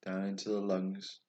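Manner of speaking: usual speaking voice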